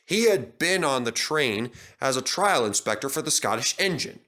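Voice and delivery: plain reading voice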